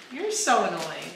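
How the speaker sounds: normal talking voice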